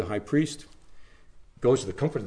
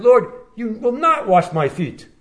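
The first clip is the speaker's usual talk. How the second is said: raised, higher voice, refusing outright; indignant protest; 9 semitones higher, 7 dB louder than usual. Acted. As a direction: Peter pushing back at his master. Peter protests to Jesus.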